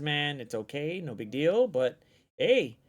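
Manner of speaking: normal talking voice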